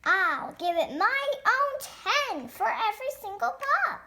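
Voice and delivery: ordinary talk, nothing marked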